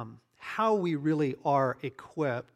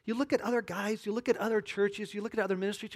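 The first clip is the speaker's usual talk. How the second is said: ordinary delivery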